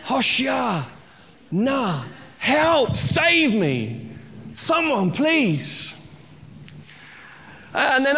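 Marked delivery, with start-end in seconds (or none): 0.0-5.9 pleading Hoshia, na, much higher, acted desperation; mock cry for help. Preacher retrying the drowning man's cry, hammed-up plea. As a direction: cry out for rescue, half in jest. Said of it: "I don't know, but".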